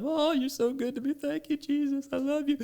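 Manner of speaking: high, gushing worshipper's voice; imitating a tearful, overjoyed prayer; mock tearful praise. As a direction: gush thanks to Jesus up high. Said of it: "I'm crying and stuff".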